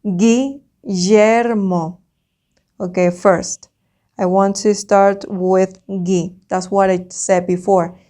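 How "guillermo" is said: "Guillermo" said very slowly; slow school dictation. Shown as a demonstration. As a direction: stretch out each syllable of "Guillermo". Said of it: "Next one".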